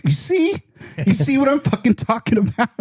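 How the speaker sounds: high-pitched, triumphant i-told-you-so; vindicated friend. Retold or imitated. Friend after the confused Walmart call, triumphant and high. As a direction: shoot it up high, proving the point.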